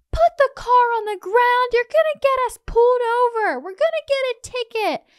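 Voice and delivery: high, loud panicked yell; yelling at Thomas. Retold or imitated. Wife yelling at Tom driving the flying truck. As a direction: yell at him to get the car down. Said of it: "I'm just yelling at Thomas".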